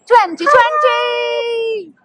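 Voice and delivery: slow, drawn-out excited call; excited shout. Real, not acted. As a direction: announce the destination with a cheer. Excited announcement of destination.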